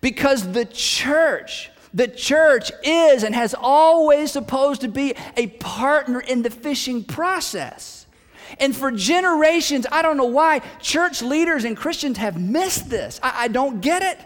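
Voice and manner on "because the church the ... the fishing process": each word drawn out slowly; slow emphatic point. Real, not acted. Preacher driving home his key point to the church, slow and emphatic. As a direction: lean on every word, slowly. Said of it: "preacher stresses key point".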